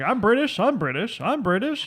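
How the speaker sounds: high, sing-song mock boast; teasing high-pitched impression of gloating. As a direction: imitate someone crowing proudly. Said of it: "Mom was gloating all morning".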